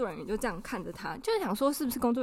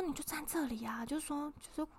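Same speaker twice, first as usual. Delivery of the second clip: much softer, sneaky aside; telling the friend to cut in, hushed; conspiratorial hush; 6 semitones higher, 11 dB quieter than usual. Retold or imitated. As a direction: murmur it like a scheming friend. Friend whispering scheme.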